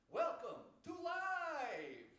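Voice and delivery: big, slow showman announcer. Acted. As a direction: belt it like a TV host. Mock announcer over dead mic.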